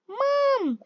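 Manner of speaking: high, loud call across the house; shouting for Mom. Acted. Child calls Mom to share news, loud excited shout. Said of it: "I shouted".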